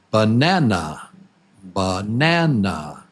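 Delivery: "banana" with the middle NA long and strong; strong second syllable. Shown as a demonstration. Teacher asks which beat is strong, "banana" stressed on its second syllable. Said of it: "Which is the strong syllable?".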